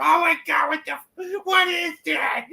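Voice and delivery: high, disgusted yell; disgusted shout. Retold or imitated. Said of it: "everyone in the fucking classroom".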